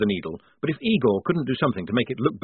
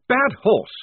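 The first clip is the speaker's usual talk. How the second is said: high, louder stern rebuke to a horse; severe, raised-voice reprimand; severe scolding; 12 semitones higher, 8 dB louder than usual. A narrator's reading. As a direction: scold the horse like a prim officer. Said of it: "said Blouse severely".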